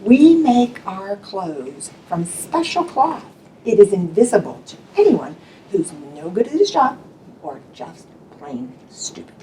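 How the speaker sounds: a trickster whispering to the principal; whispered. Acted. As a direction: lean in and confide. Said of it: "he whispered in Mister Bundy's ear".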